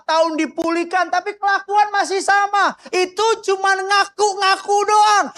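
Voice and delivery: high, ringing preacher's shout; fired-up preaching. Real, not acted. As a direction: drive each phrase out high and insistent. (khotbah berapi-api bernada tinggi)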